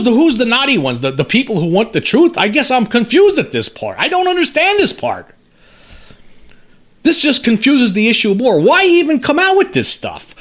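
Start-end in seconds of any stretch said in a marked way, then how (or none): 4.0-7.9 exasperated disbelief. Real, not acted. Exasperated rant about the article. 8.6-10.3 incredulous outburst. Real, not acted. Exasperated rant about the article.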